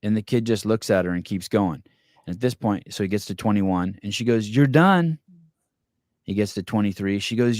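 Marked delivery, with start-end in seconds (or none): 4.5-5.2 higher, louder mock command; raised mock call. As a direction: imitate the nanny cutting him off. Nanny repeating to kid.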